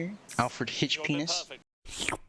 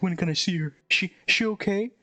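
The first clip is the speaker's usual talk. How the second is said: higher-pitched, lightly acted concern; mock worried; 6 semitones higher than usual. Boyfriend asking after her at the hospital, mock worried. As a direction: ask like an anxious boyfriend, played lightly.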